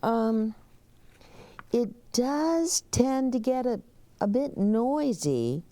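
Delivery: high, hesitant bellhop warning; nervous high bellhop voice. Acted. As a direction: warn him in the bellhop's high voice. Bellhop warns.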